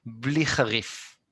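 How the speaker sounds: usual speaking voice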